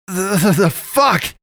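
high, stuttering panicked yell; stammering horror. A narrator's reading. Man stumbling backward in horror, high stuttering yell. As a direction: stammer out the shock. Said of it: "he repeated".

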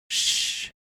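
sharp whispered shush; scolding shush. Acted. Pastress silencing Naomi, whispered scolding shush. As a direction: hush her with a scolding hiss. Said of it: "the Pastress scolded".

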